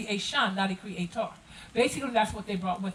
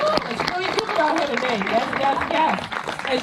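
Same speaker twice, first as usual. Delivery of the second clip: excited; 3 semitones higher, 7 dB louder than usual. Real, not acted. Cheerful farewell to audience.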